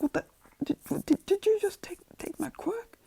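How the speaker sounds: high, strained stammer; Bakugo shocked. Acted. Bakugo asks if his quirk was taken, shocked stammer. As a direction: stammer in strained, acted disbelief.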